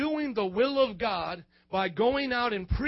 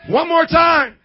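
loud rally cry for the congregation; shouted call; 6 semitones higher, 14 dB louder, slower than usual. Real, not acted. Preacher shouting at congregation for a louder response. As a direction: shout it out to the room. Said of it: "Say it like you up today".

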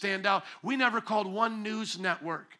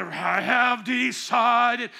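slow booming wrestler singing; wrestling promo; 3 semitones higher, 7 dB louder, much slower than usual. Pretend. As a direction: drag out each word like a wrestler. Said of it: "crazy W W F wrestler voice".

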